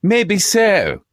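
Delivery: high-pitched mock-posh retort; hammed-up batsman. Co-host parodying the batsman's comeback, hammed-up high voice. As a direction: ham it up high, each phrase swooping down at the end.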